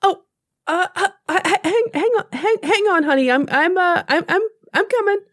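hurry, flustered, coming. Acted. Tammy Jo rushes to help.

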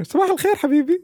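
high-pitched mock wake-up call; playful earpiece greeting. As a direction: chirp a morning greeting into a friend's ear. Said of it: "like, there in your ear".

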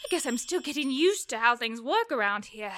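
ordinary talk, nothing marked

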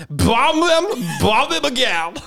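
hammed-up TV newscaster delivery; newscaster impression. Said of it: "How does she speak?".

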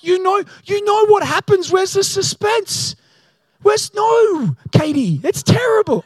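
high, loud mock outrage; squeaky-high exasperated protest; comic exasperation. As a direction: rant in hammed-up disbelief. Acted exasperation at people reading endings.

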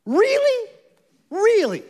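high, loud disbelieving "Really?"; incredulous really. Retold or imitated. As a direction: play Paul aghast and baffled. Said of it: "starts his letter to the Galatians saying".

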